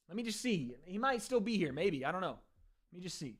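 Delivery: getting farther from the microphone; walking away from mic. Real, not acted. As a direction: voice fading off as he leaves the mic. Streamer walks away from mic.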